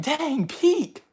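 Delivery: amazed exclamation. Retold or imitated. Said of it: "I'm just like".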